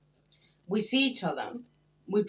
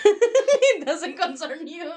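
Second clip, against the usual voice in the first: higher and slower through laughter; laughing; 9 semitones higher, 8 dB louder, much slower than usual. Acted. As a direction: say it laughing, mock blunt. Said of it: "I'm polite".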